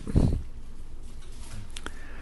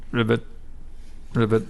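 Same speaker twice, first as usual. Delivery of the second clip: frog croak; 4 semitones lower, 4 dB quieter than usual. Acted.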